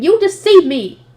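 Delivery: high, loud mock wail with drawn-out words; tearful scream. Imagined fiancée confronting Ferdinand in tears, mock screaming. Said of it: "running to Ferdinand in tears and screaming".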